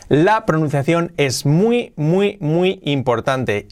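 muy, muy, muy alargados y lentos; énfasis lento. Shown as a demonstration. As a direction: recalca cada muy despacio. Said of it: "insiste en la importancia". (énfasis pausado en muy)

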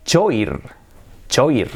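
choir as spelt, CHO-eer; lectura literal. Shown as a demonstration. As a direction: read "choir" letter by letter with ch. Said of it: "se escribe". (choir leído como 'cho-ir')

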